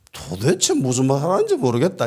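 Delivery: high, agitated protest; flustered denial. Acted. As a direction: deny it in a fluster. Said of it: "당황합니다".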